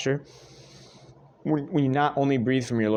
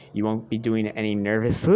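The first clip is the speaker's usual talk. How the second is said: ordinary talk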